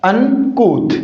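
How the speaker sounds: "अनकूथ" split in two, un... koot, falling at the end; correct pronunciation. Shown as a demonstration. Teacher repeating uncouth's correct sound for students, careful model pronunciation. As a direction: model "अनकूथ" syllable by syllable with a long "oo". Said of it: "क्या होगा correct pronunciation इसका".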